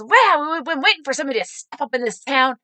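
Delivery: thrilled supporter. Retold or imitated. Supporter praising the candidate for stepping up, thrilled. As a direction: gush like a grateful fan.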